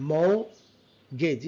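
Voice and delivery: MORTGAGE split as "maw... gej"; syllable by syllable. Shown as a demonstration. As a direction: pause between the two halves of mortgage. Teacher breaks word into syllables. (mortgage ভেঙে "মো... গেজ")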